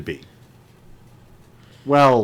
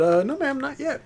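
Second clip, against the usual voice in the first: polite high voice; 3 dB quieter than usual. Retold or imitated. Own polite reply, high.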